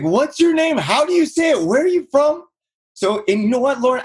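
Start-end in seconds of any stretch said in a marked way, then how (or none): 0.0-2.4 high mocking voice of wary strangers; imitating suspicious callers. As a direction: pitch it way up as the puzzled homeowner.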